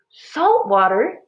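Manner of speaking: slow, loud, high outcry; surprised exclaim. A narrator's reading. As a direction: spit out the shock. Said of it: "Minley exclaimed".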